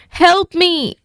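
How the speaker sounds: high pleading plea; begging. A narrator's reading. Jake on the ledge begging the dragon for help. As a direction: beg the dragon to save him. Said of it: "begged Jake".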